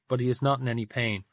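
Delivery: ordinary delivery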